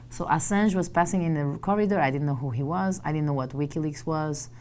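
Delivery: ordinary talk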